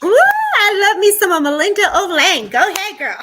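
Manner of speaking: high, loud cheering; excited. Real, not acted. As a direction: cheer your friend on. Cheering on the chosen reader.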